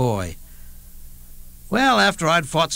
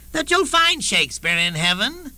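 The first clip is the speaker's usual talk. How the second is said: high, superior scholar's voice; a smug scholar looking down on him; haughty Baconite; 9 semitones higher than usual. Retold or imitated. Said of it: "said this Baconite".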